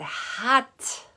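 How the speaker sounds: high, punchy flirty grandma; grandma's sassy answer. Retold or imitated. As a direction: snap it out like a cheeky old lady. Grandma's one-word flirty answer.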